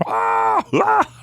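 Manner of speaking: very high-pitched mock scream; imitated distant scream. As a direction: cry out high, mimicking screams from the woods. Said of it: "it just sounded like scream".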